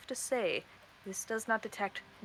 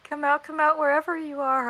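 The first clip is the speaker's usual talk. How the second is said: higher, louder teasing call; taunting sing-song; 6 semitones higher, 7 dB louder than usual. Acted. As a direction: come out, come out taunt. Taunting hide-and-seek call.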